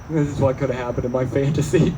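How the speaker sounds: ordinary talk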